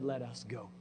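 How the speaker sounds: 1 hushed. Acted. Quieter reading of Jesus' words.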